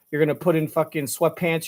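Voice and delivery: normal talking voice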